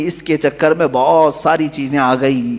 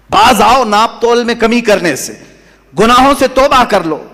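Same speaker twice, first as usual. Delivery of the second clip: higher-pitched, forceful prophet's admonition; stern warning; 7 semitones higher, 4 dB louder than usual. Retold or imitated. Prophet warns his people against cheating and sin, stern warning. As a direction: urge them to repent, raised and insistent. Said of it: "اس قوم کو جب ڈرایا تھا".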